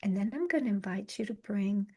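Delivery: soft, unhurried meditation voice with long pauses; guided meditation. Acted. Yoga teacher guiding a listener through the senses, calm meditation voice. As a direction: guide them gently inward, leaving space between phrases.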